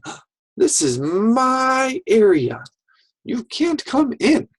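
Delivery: high, loud, stretched-out pretend defiance; playful fort defender. Child warns intruders away from the fort, playful defiance. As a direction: play-act guarding your territory, pitched right up.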